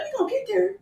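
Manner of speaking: high, soft mock whine; mocking his line. Wife mimicking her husband's excuse, high mock whine. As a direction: echo his sleepy excuse in a whiny voice. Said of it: "I'm like".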